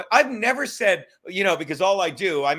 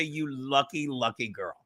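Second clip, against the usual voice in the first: smug mock charm; 5 semitones lower, 8 dB quieter, slower than usual. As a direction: lower and softer, a smug self-satisfied purr. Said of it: "kiss her goodbye and say".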